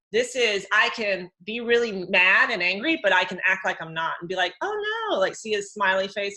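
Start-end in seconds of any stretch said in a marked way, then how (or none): 4.6-5.2 high, sugary "oh no" while hiding anger; put-on innocence; fake sweet denial. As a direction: sing it out falsely sweet. Acting sweet while angry.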